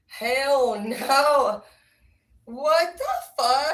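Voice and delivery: loud, high, drawn-out disbelief; shocked outburst. Acted. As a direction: blow up in stunned protest. Acted shocked reaction to the scene.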